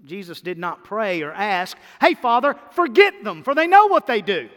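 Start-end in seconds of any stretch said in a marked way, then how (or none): 2.0-4.5 high and loud, a flippant wrong version; mock careless prayer. Preacher mocking a wrong prayer, loud and high. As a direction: toss it off loudly like a careless request. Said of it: "He didn't say that".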